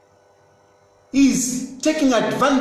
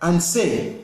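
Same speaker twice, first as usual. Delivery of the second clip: ordinary talk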